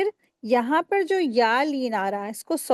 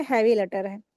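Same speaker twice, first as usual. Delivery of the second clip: normal talking voice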